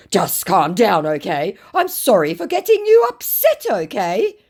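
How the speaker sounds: loud, high, hammed-up apology; mock apologetic scammer. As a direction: placate and climb high on the apology. Acts scammer apologising and placating.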